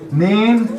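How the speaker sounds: "Neen" with a long ee sound, slowly; Alsatian nine. Shown as a demonstration. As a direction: draw out "Neen" like neeen. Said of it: "nine and neen".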